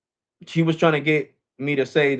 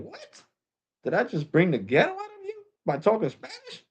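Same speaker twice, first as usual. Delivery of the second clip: very high, soft squeal of mock disbelief; incredulous; 10 semitones higher, 5 dB quieter than usual. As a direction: act out stunned disbelief, pitched way up. Acted incredulous inner reaction to insult.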